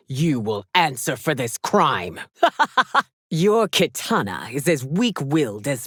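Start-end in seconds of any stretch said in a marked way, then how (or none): 2.2-3.0 a short, high, loud mocking laugh; haughty laugh. Empress laughing at a beaten foe, high and loud. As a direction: laugh down at them from the throne.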